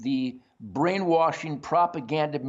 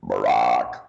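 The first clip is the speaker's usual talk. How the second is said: very low, loud monotone croak; gag croak; 6 dB louder than usual. Pretend. Host stands in for banned word, croaked. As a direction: belch the name out on one deep note.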